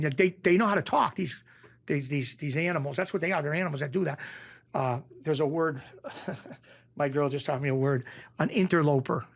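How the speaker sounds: ordinary talk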